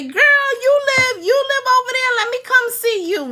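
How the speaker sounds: high-pitched mimicked family member; eager relative. Imagined family member asking to visit, high eager mimicry.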